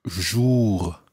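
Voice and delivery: JOUR with a long, rounded French oo vowel. Shown as a demonstration. Teacher modelling the second syllable of bonjour, rounded vowel. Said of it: "Watch the vowel sound that you make there".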